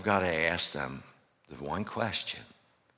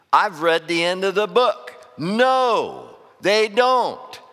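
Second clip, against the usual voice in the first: excited; 10 semitones higher, 8 dB louder, slower than usual. Real, not acted. Preacher's rising punchline.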